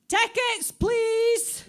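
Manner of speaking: high, loud bark of a ticket inspector; crabbit ticket inspector shout. Acted. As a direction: call it down the carriage like a crabbit conductor. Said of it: "a wee crabbit woman comes ben shouting".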